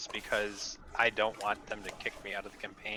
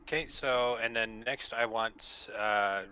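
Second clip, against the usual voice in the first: ordinary talk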